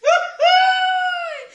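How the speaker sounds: big high burst of laughter; loud laugh. Real, not acted. Reacting to begging video.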